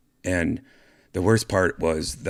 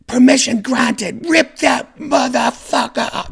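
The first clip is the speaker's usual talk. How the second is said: high, loud, slow mock-official shout; hammed-up posh stewardess granting it; mock British stewardess; 7 dB louder than usual. British stewardess returning from the phone, mock official shout. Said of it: "No, she didn't say that".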